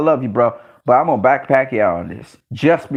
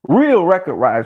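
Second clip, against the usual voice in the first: ordinary talk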